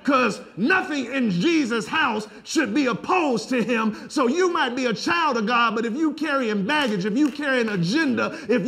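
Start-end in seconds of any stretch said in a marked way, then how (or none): none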